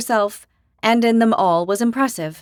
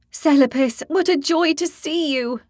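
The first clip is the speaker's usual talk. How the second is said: higher, delighted greeting, drawn out slow; joyful cry; 8 semitones higher than usual. A narrator's reading. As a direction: call out with joy at an old friend. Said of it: "Ingrid cried".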